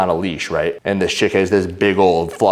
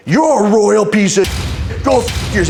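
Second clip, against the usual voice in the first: high, louder, drawn-out mock outrage; imitating ranting woman; 12 semitones higher, 3 dB louder, slower than usual. Woman digging in on man with phone, mock high-pitched yelling. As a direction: mimic her digging in, pitched way up. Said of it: "she just starts digging in on me".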